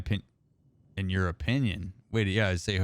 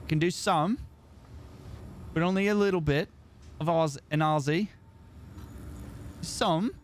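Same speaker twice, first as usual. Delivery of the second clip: high-pitched, shaky grasp of an Aussie accent; attempted Australian accent; 9 semitones higher than usual. Shown as a demonstration. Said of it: "I have a hard time doing an Australian accent".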